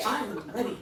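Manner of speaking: high, bright eager jump at it; eager excitement. Retold or imitated.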